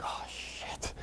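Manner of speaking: retold whispered oh-no; whispered dismay. Doctor realizes her patient is crying, whispered dismay.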